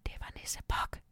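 soft, breathless whisper. A narrator's reading. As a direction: whisper it so the nisse won't hear. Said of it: "hvisker jeg".

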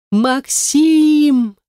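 high, drawn-out cry of the name; screaming for help. A narrator's reading. Woman thrown into car trunk calls for help, high cry. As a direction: call out high and stretch the name. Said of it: "Я собрала все силы для крика".

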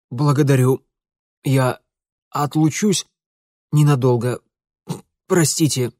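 running out of air between coughs; choked words squeezed out between coughs; coughing, forced out. Acted. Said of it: "перхая кашлем, выдавил Митя".

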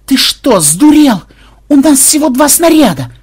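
high, loud, frantic yell; desperate protest. A narrator's reading. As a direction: plead at full voice to stop a madman. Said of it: "Отчаянно вцепился в него Ксенобайт".

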